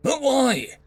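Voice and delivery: whiny alien daughter. Acted. Daughter protests.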